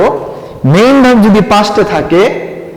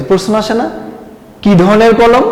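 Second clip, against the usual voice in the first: ordinary talk, nothing marked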